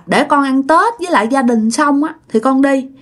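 put-on high, wheedling little child; whiny child. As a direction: coax grandpa like a spoilt kid. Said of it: "làm theo kiểu như con nít mà nhõng nhẽo".

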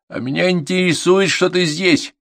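irritated instructor; annoyed scolding. A narrator's reading. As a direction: tell a pupil off with exasperation. Instructor scolds the answering pupil.

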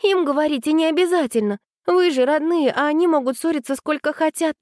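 put-on high, quick girl's voice; high-pitched, hurried objection. A narrator's reading. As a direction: speak up quickly in a high young voice. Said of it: "быстро заговорила Аня".

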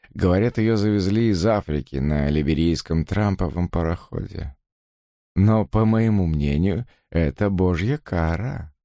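slow, gloating, dramatic; gloating drawl. Acted. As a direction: savour every grim word. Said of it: "с безжалостным удовольствием драматично процедил".

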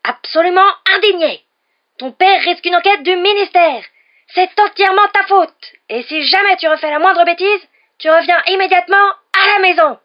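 mother roaring through a magic letter at her son; Mrs Weasley howler. A narrator's reading. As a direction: scream it like a letter bellowing at a son. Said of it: "la voix qui lui perçait les tympans".

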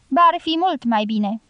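plain reading voice

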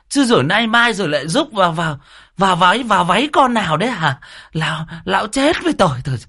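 scolding wife. A narrator's reading. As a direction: scold the drunk husband nonstop. Wife drags drunk husband home. (vợ chì chiết mắng chồng, giọng the thé)

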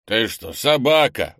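loud, slow, incredulous question; surprised. A narrator's reading. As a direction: ask in loud disbelief, drawing it out. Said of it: "удивился Крис". (удивлённо, громко и протяжно)

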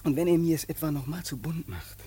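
soft, low whispered threat; menacing whisper. Acted.

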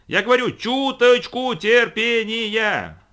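very slow, high, loud shout. A narrator's reading. Quota repeating himself over the roar, slow loud shout. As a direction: spell it out at a yell over the din. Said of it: "оба они орали во всё горло".

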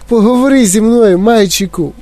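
high-pitched motherly begging; pleading. A narrator's reading. Mother begging her son to talk, pleading. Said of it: "благала пані Т В".

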